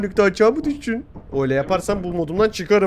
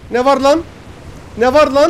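startled; 5 semitones higher, 6 dB louder than usual. Real, not acted. Startled shout during play.

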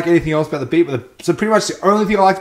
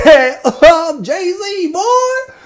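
very high, slow, squeaky mock [name]; bad [name] impression; 13 semitones higher, 8 dB louder, much slower than usual. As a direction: ham up a terrible [name] voice. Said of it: "the worst [name] impersonation I've ever heard".

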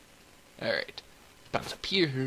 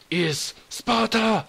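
high, drawn-out heroic shout; mock battle cry; 8 semitones higher, 4 dB louder, much slower than usual. Cat wrecking the room, mock-epic shout. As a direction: bellow it slowly like a movie war cry.

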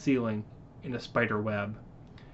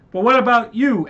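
higher, louder anxious plea; worried protest; 9 semitones higher, 9 dB louder than usual. Acted. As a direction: protest in fear for him.

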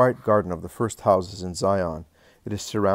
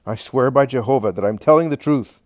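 heated, louder sworn oath; angry oath; 4 semitones higher, 8 dB louder than usual. Acted. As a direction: swear it in a flash of temper. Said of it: "Judas flares up in a temper".

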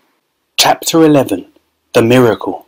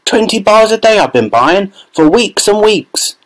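angry shout; 9 semitones higher than usual. A narrator's reading. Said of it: "a large boy shouted angrily".

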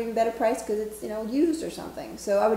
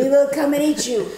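playful menace; 5 semitones higher, 9 dB louder than usual. Pretend. Mock vampire threat.

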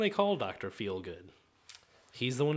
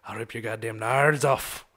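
put-on Irish accent; 5 semitones lower, slower than usual. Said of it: "that's my Irishman".

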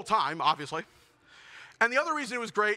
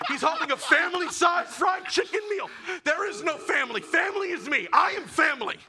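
mock outraged yell; 5 semitones higher than usual. Comedian yelling to the crowd about the delivery guy. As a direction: yell it like a wounded confession. Comic climax of delivery story.

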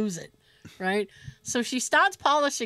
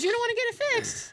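hygienist voice; 11 semitones higher than usual. Retold or imitated. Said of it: "she goes".

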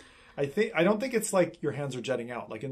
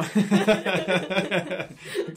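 loud burst of laughter; long laugh, louder; hearty laugh; 6 semitones higher, 8 dB louder than usual. Real, not acted. Laughs at chat's joke vote.

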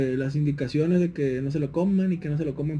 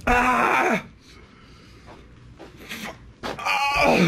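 pained groan; 5 semitones higher, much slower than usual. Real, not acted. Enduring burning after swallowing.

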